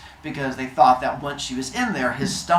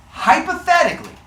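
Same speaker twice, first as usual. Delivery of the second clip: nervous hedge; 7 dB louder than usual. Retold or imitated. Hephaestus explaining nervously.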